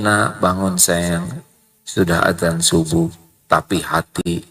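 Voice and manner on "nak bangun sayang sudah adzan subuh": slow, lower, tender waking; gentle. Retold or imitated. Parent gently waking a child for dawn prayer. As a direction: coax the child awake kindly. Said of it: "Sambil bicara baik-baik".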